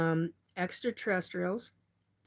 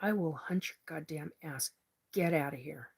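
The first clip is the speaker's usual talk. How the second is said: really pissed off; 3 semitones lower, 7 dB quieter than usual. Real, not acted. Threatening the intruder in astral, low and seething. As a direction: growl out the threat under your breath. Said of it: "And I'm really pissed off".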